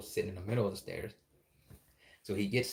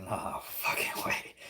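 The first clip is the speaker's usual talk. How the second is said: slow, whispered disbelief; drawn-out, lower hushed refusal; stunned whisper; 4 semitones lower, much slower than usual. Retold or imitated. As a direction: whisper it slowly, acting out the shock. Stunned at seeing the doll moved.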